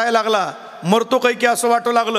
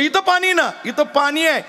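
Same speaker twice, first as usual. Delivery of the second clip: higher pointing out the water; insistent wife; 6 semitones higher than usual. Retold or imitated. Wife points at the water by the pillow, insistent and higher. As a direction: stress where the water is, annoyed. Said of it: "म्हणलं".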